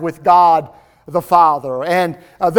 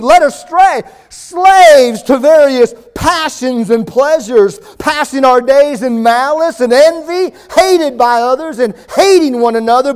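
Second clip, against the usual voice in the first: Titus read higher and louder, building; fervent preaching; 4 semitones higher, 3 dB louder than usual. Acted. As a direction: proclaim the verse like a sermon climax. Preaching scripture at full force.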